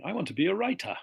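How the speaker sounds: high, loud British demo with crisp T's; British accent. Shown as a demonstration. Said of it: "If you can hear lots of T's".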